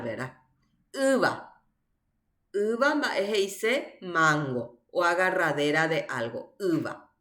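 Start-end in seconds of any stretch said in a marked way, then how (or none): 0.9-1.6 Iba with a high stressed I, then falling; first-vowel stress. Shown as a demonstration. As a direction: lift and stress the I that opens Iba. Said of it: "acento en la primera vocal".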